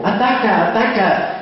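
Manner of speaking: high, falling cries of ataca; playful shout. Acted. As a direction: egg the dog on in play. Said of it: "le grito en juego".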